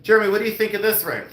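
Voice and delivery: high, loud, rushed question; mock eager asking. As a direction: act out calling for an opinion on the fly. Re-enacting asking sleeping helper.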